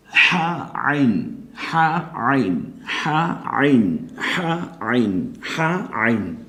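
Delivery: হা আইন, drawn out very slowly from the throat; slow drill. Shown as a demonstration. Teacher slowly modelling throat letters for students. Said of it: "আপনি উচ্চারণ করুন".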